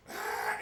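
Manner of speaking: sudden loud holler; loud wordless outburst; retold wild yell. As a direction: burst out with a raw "Ah!".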